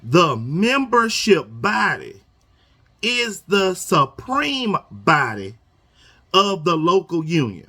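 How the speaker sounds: very slow, stretching supreme. Shown as a demonstration. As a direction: drag it out to drive the point home. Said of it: "I'ma read this slow".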